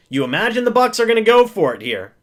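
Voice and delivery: usual speaking voice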